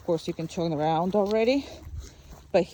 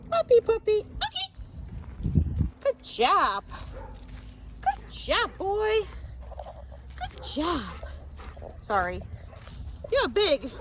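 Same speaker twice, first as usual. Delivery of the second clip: sing-song, much higher praise for a puppy; high-pitched dog praise; 12 semitones higher, 3 dB quieter than usual. Acted. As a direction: gush over the good boy like baby talk. Praising the dog in pet talk.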